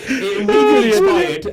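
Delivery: high, loud laughing reply. Real, not acted. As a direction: laugh through the words. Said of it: "This is why I'm laughing too".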